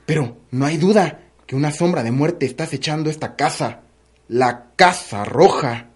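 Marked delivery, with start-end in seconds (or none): 4.3-5.9 very slow, low, portentous; ominous. Acted. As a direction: draw the title out low and slow. Ominous close of the episode. (tono siniestro, lento y grave)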